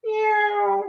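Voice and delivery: high squeaky meow; cat meow. Shown as a demonstration.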